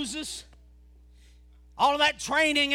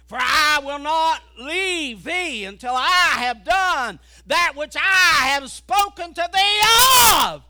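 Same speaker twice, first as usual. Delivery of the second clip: building proclamation; 9 dB louder than usual. Acted. Preacher reading God's promise to Jacob, building loud.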